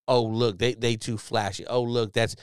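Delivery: usual speaking voice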